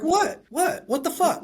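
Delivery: high, baffled What the fuck; blurting it out in disbelief; incredulous outburst. Retold or imitated. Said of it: "And I'd be like".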